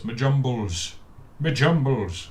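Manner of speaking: put-on funny troll accent. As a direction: put on a hint of a silly little troll accent. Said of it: "give the troll a funny little accent".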